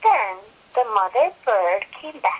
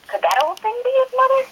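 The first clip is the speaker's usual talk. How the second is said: high, fast excited wonder; excited question; 12 semitones higher, 5 dB louder, faster than usual. Acted. Reading to her child, a high fast hopeful question. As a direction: squeak it out quickly with hope.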